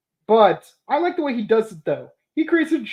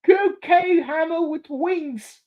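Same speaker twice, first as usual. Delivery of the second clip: mock quote; 8 semitones higher, slower than usual. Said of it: "That's the funny line".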